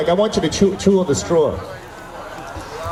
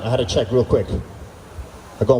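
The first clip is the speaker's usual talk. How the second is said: ordinary talk, nothing marked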